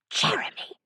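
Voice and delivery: sharp unvoiced hiss; hissed whisper. A narrator's reading. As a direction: hiss the name in an ear. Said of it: "Freddie hissed in my ear".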